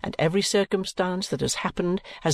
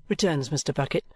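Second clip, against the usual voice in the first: plain reading voice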